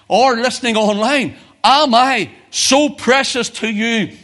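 high, loud pulpit appeal; impassioned preaching. Real, not acted. Preacher putting Jesus' question to each listener, impassioned.

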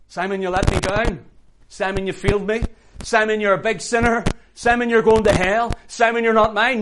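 high, loud accusing yell; shouted condemnation. Acted. Imagined Jesus condemning Simon for failing him, harsh shout. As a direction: hurl each charge at full volume.

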